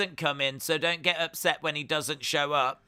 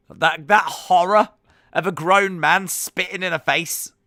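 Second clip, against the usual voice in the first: louder, hammed-up dramatic outrage; mock horror; 5 semitones higher, 9 dB louder than usual. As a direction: ham up the horror for laughs. Mock-dramatic about the spit.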